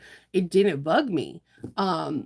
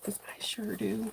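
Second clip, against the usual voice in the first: whisper; 3 semitones higher, 10 dB quieter than usual. Real, not acted. Confessing aside to viewers.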